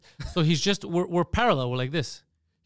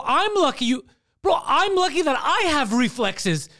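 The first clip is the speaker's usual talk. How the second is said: loud, high, drawn-out retold rant; incredulous, worked-up argument retold; outraged comeback; 10 semitones higher, 5 dB louder, slower than usual. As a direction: stretch each point in indignation, reenacting. Retold furious comeback to driver.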